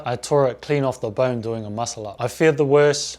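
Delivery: ordinary talk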